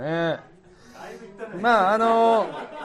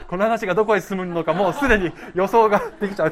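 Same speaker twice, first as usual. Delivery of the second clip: laughing; 3 semitones higher, 7 dB louder, faster than usual. Real, not acted. Cracks up at own story.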